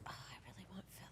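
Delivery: fast breathless whisper. Retold or imitated. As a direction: confess it under your breath. Inner craving voiced as quote.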